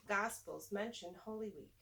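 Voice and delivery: ordinary delivery, nothing marked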